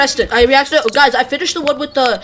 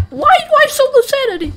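high-pitched dismay; 11 semitones higher, faster than usual. Real, not acted. Dismayed at low sanity.

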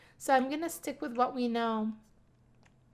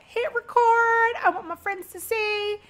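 excited shriek; 9 semitones higher, 6 dB louder than usual. Retold or imitated. Retelling her squeal to viewers, high and loud. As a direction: squeal with glee at the news. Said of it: "And I shrieked".

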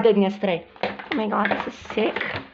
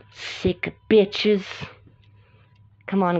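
ordinary delivery

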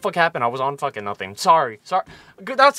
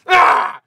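a sudden loud high shout; excited yell; 12 semitones higher, 10 dB louder than usual. Real, not acted. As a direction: let out a burst of excitement. Excited outburst about abs.

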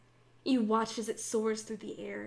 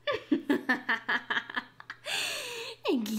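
evil cackle; 11 semitones higher than usual. Acted. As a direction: cackle like a triumphant villain. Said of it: "She cackles".

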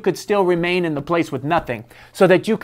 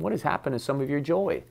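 soft earnest question; 10 dB quieter than usual. Real, not acted. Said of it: "Can I just ask this question".

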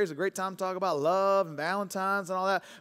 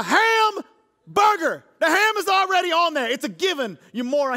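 much higher, louder, very slow on hamburger; exasperated mock rant; 9 semitones higher, 7 dB louder, slower than usual. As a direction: vent at the cashier in outrage. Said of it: "I, I said".

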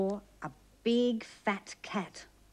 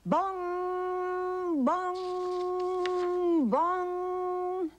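high ringing bong sounds; bell bongs; 9 semitones higher, much slower than usual. A narrator's reading. Narrator imitating the church bell, high ringing bongs. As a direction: ring out like a big church bell.

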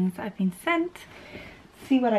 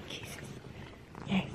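whispering; 16 dB quieter than usual. Real, not acted. Whispering to the cat.

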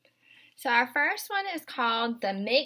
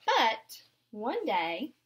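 gentle picture-book read-aloud; storybook narration for little ones; reading to children; 3 semitones higher than usual. Acted. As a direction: read it aloud to a class of young kids. Teacher reads picture book aloud.

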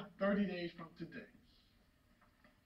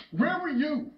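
accusing demand; 3 semitones higher, slower than usual. Acted. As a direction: demand it forcefully from your son. Repeats demand to son.

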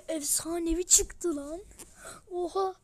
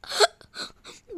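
breathy laugh; 8 semitones higher, 7 dB louder than usual. Real, not acted. Laughing at his luck.

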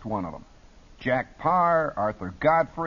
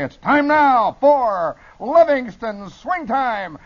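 loud, high, drawn-out show announcement; slow, shouted radio hype; wild jazzy deejay; 10 semitones higher, 10 dB louder, much slower than usual. Shown as a demonstration. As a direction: belt it like a wild swing-show host. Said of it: "the wildest disc jockey you ever heard".

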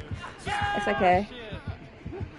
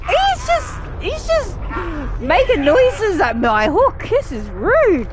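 indignant; 6 semitones higher, slower than usual. Real, not acted. Indignant laughing complaint.

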